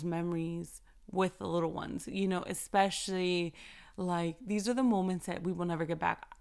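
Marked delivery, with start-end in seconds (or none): none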